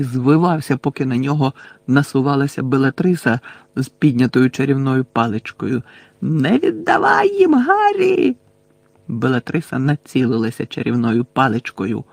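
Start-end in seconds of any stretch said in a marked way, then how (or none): 6.2-8.4 high, drawn-out desperate yell; ревіння Невіла. A narrator's reading. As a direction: cry out slowly, voice leaping high. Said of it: "ревів Невіл, що наче сам не свій". (відчайдушний протяжний високий крик)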